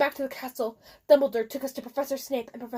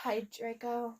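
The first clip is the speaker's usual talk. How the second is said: depressed; 10 dB quieter, slower than usual. A narrator's reading. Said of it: "I said in a depressed voice".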